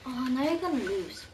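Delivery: ordinary talk, nothing marked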